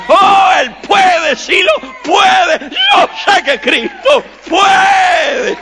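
high, drawn-out pulpit shout; full-force preaching. Real, not acted. Preacher testifying to the congregation about forgiveness, full-force shout. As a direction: cry it out with total conviction, holding the big words.

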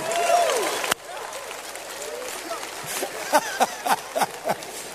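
real high-pitched laughter; high laughing fit. Real, not acted. Preacher cracks up at his ceviche joke, laughing.

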